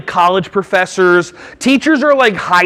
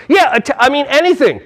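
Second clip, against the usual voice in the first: usual speaking voice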